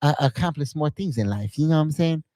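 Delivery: hesitant, earnest boxer impression; Mike Tyson. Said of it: "All right, what about Mike Tyson?".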